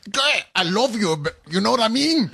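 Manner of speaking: high, hammed-up fake Cuban accent; mock a bad Cuban accent like coughing up hairballs; Scarface-style Cuban parody; coughed-up Cuban accent. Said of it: "like he was coughing up cough balls".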